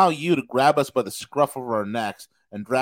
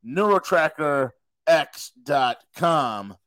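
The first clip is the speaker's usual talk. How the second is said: slow emphatic plug; much slower than usual. Acted.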